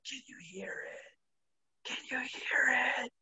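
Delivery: slow high ghostly whisper; eerie whisper. Retold or imitated. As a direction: whisper it like a voice in your head. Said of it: "the voice is like".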